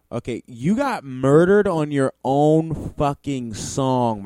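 loud and slow, ranting. Real, not acted. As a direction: hammer it home loudly. Ranting about the beatdown.

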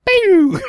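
sharp high "pew" zap; high pew sound effect. Pretend. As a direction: fire a cartoon laser.

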